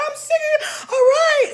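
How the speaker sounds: high, loud, breaking cry; tearful outburst. Retold or imitated. Arguing with her man in the hallway, tearful loud outburst. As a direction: wail it out, fed up.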